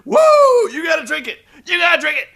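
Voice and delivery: high, loud whooping wrestler impression; hammed-up jubilant shouting with big whoops; Ric Flair celebrating. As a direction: holler it like a showboat mid-party. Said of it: "Ric Flair's all celebrating".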